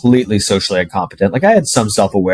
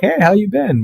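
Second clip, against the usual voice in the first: much higher, bright mock girl greeting; excited girl; 11 semitones higher than usual. Girl in library greets him, mock excited. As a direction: squeal a happy hello.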